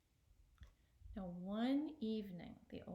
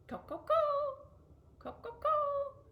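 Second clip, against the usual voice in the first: much higher, loud, slow clucking "co-co-co"; rooster clucking; 11 dB louder, slower than usual. Acted. Rooster tapping the stove to the old man, loud high clucking.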